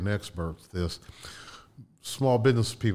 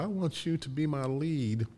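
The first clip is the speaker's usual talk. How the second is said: lightly imitated president, pitched higher; slow, raised voice for the president's request; President Clinton; 6 semitones higher, slower than usual. President offering the lead role, lightly imitated high voice. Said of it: "And he said".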